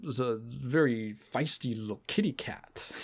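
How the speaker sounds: soft, slow, low-key flirty purr, a few shades off her own voice; Silas's flirty voice. Retold or imitated. Character Silas teasing the player during the crane game, soft slow purr. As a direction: lightly purr it like a teasing love interest. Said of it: "And he goes".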